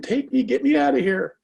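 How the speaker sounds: high, mock-panicked squeal of a lobster; mock lobster scream. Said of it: "They're sitting there screaming".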